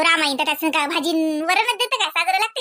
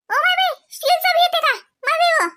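high, loud surprised exclamation; genuinely taken aback, voice jumps up; amazed; 12 semitones higher, 3 dB louder than usual. Real, not acted. Chatting with Satutai, surprised by a steel cooker.

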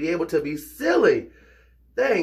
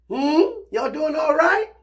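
much higher, slow, sing-song "y'all doing all right"; high greeting; 13 semitones higher, 5 dB louder, slower than usual. Acted. Greeting viewers in a high sing-song. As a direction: coo it at the cousins.

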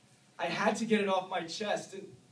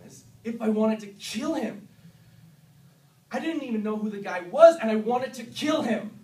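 building anger; 5 semitones higher, 9 dB louder than usual. Acted. Recalling sudden rage at stranger.

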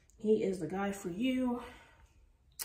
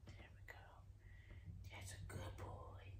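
soft whisper; 20 dB quieter than usual. Real, not acted. Praising puppy softly.

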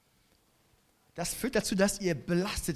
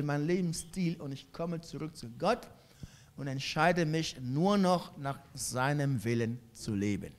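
usual speaking voice

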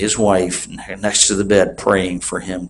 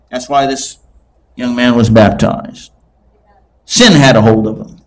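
ordinary talk

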